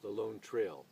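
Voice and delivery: rolling, dramatic poetry reading with swelling lines; solemn recital of a frontier poem, rising and falling; poem recitation. Acted. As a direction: declaim the verse like a lone traveller's hymn. Recites a Robert Service poem.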